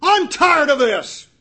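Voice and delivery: loud, high, exasperated cry of giving up; fed-up outburst. Pretend. Christ giving up on the ungrateful disciples, loud exasperated outburst. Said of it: "had thrown up his hands".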